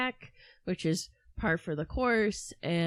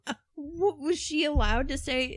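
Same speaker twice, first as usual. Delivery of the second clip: sarcastic mock voice; 7 semitones higher, 3 dB louder than usual. Speaker voicing mother's imagined reply.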